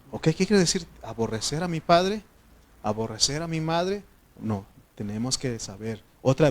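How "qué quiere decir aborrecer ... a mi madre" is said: ordinary delivery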